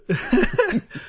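short high laugh; high giggle burst. Real, not acted. Laughing at friend.

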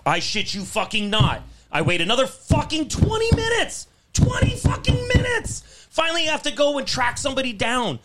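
indignant shouting rant; furious ranting shout. Real, not acted. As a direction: yell it like you still can't believe it. Furious retelling of the wait.

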